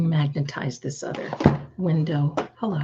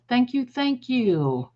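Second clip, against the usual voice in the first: higher, slow gushing thank you; 8 semitones higher, slower than usual. Real, not acted. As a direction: pour out the thanks brightly. Thanking viewers.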